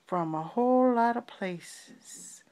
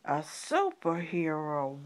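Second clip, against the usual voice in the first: ordinary delivery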